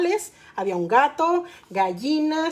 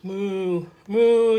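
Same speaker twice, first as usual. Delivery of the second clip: cow moo; 6 semitones lower than usual. A narrator's reading. Storyteller imitates the cow, mooing low. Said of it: "hizo la vaca".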